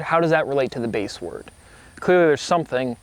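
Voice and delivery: ordinary talk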